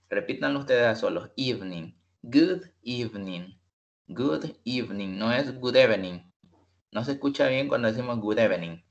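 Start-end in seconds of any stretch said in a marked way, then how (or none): none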